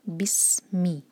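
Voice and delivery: बिस्मी with ब joined straight to स; recitation demo. Shown as a demonstration. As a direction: glide बिस्मी with no jolt. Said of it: "बा को हम सीधा सीन से जोड़ेंगे". (बिस्मी बिना झटके)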